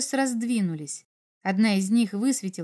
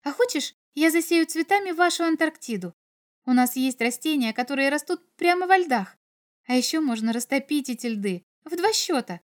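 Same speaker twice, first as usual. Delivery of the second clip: put-on higher, lively girlish voice; eager girl; 5 semitones higher than usual. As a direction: offer it eagerly, lightly voicing a girl. Girl makes eager offer.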